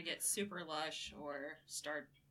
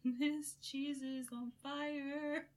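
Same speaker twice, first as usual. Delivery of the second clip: dramatic slow exclaim; 9 semitones higher, much slower than usual. Real, not acted. Tasting very smoky cheese.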